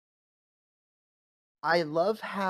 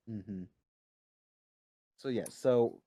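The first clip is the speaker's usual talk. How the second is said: ordinary talk, nothing marked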